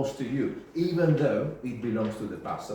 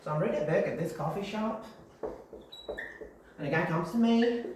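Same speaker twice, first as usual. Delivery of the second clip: high, chatty American anecdote voice; gossipy American accent, higher than usual; 7 semitones higher, faster than usual. Shown as a demonstration. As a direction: tell it in an American accent, pitch climbing at the end. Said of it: "I'm using sort of American accent".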